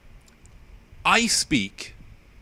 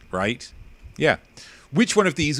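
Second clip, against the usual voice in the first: ordinary talk, nothing marked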